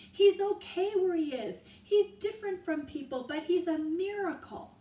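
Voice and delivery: loud, high urgent plea; shouted plea. A narrator's reading. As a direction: beg them to listen. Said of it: "yelled Jack".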